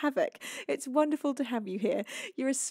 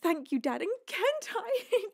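ordinary talk, nothing marked

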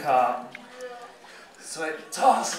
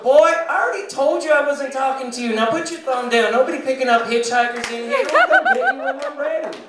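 loud, much higher telling-off; scolding desk lady; 10 semitones higher, 5 dB louder than usual. Acted. Desk lady to a patient she already told, scolding. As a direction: tell him off as the fed-up desk lady, high and loud. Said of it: "and she goes".

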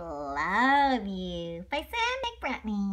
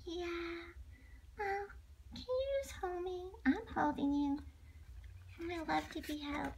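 much softer, higher sleepy voice; sleepy soft bunny; 7 semitones higher, 11 dB quieter than usual. Acted. Sleepy little bunny asking dad to hold him, soft. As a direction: drift toward sleep asking to be held.

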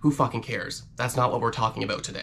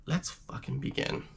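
whisper; 8 dB quieter than usual. Shown as a demonstration.

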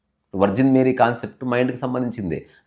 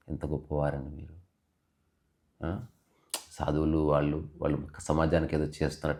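low, soft, humble reverence; Kabir; 7 semitones lower, 8 dB quieter than usual. Retold or imitated. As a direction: speak quietly, as if with folded hands. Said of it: "Kabir చేతులు జోడించన్నాడంట". (చేతులు జోడించి వినయంగా, మెల్లగా)

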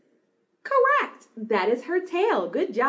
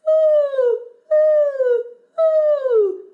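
loud, very high owl-like "ooh"; high owl hoot; 13 semitones higher, 7 dB louder, much slower than usual. Shown as a demonstration. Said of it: "high voice that sounds like an owl".

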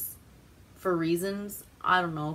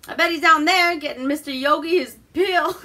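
high, loud comic impression; mimicked film character; 9 semitones higher, 7 dB louder than usual. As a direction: quote the character with hammed-up relish. Imitates film character, ends in laughter.

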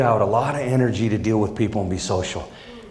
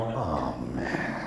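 defeated groan; 12 semitones lower, 10 dB quieter than usual. Retold or imitated. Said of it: "And I go".

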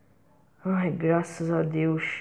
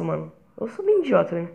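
ordinary delivery

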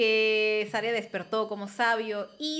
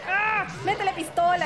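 high, loud frantic chant; excited shouting; 8 semitones higher, 4 dB louder than usual. Real, not acted. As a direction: cheer him on, frantic. Urging Link on during boss fight.

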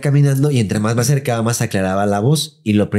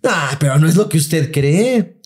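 higher, slow put-on lady tone; gossipy lady voice; 6 semitones higher, slower than usual. Scene: housekeeper teases gossip to neighbor, slow and high. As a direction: tease the juicy secret.

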